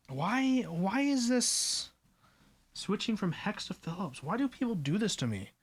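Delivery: exasperated lament. Real, not acted. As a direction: complain pleadingly, pitch up, stretching the why. Mock complaint about screw switch.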